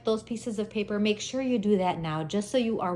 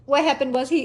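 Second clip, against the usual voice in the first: ordinary talk, nothing marked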